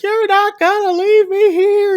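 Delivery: very high, strained retold cry; mock frantic screech. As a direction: imitate her crazed, high-pitched wail. Pearl attacks, frantic, retold.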